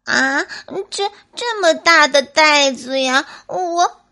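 高声结巴带哭腔; 吞吞吐吐地为难; 为难的小松鼠. Acted. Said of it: "为难地说". (为难又害怕的小松鼠)

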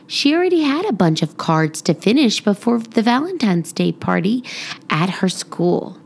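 ordinary delivery

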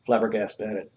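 usual speaking voice

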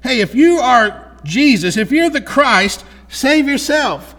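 high, slow, loud jeering challenge; a criminal on the cross taunting Jesus, slow high jeer. Acted. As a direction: sneer it up at Jesus, dragging the words. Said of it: "challenges him and laughs says".

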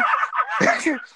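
a sudden high squeal of laughter; high cackle. Real, not acted. Cracking up at his own story, high cackle.

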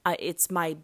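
ordinary talk, nothing marked

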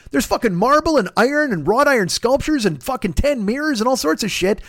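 ordinary delivery

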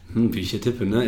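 a hint of a smug flirting cop; flirting policeman. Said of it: "der so". (leise und angeberisch, der Polizist flirtet mit der Mutter)

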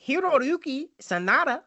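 put-on Hiroyuki Sanada said with a Spanish accent; high, sing-song Spanish-sounding name. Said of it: "Why, why did I sound Spanish?".

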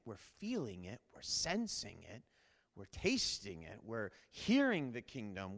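hushed, intimate sermon voice; soft preaching. Acted. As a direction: draw them in quietly.

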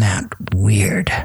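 hushed aside; whispering. Real, not acted. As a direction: drop to a whisper. Unvoiced aside.

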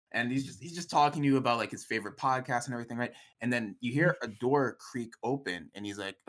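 ordinary talk, nothing marked

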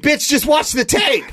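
high-pitched mock exasperation; exasperated mock outburst. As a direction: blurt it like an exasperated viewer. Acting out viewers' exasperated reaction.

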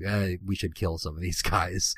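low, quiet, dejected mumble; deflated and downbeat, very soft; bummed out. Acted. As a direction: sound glum, voice dropped down low. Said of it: "is bummed out for some reason".

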